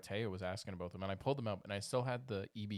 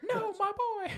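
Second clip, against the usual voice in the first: loud shrill squeak; squeaky high voice; 5 dB louder, much slower than usual. Pretend. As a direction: shriek it in falsetto. Silly high-voiced bit.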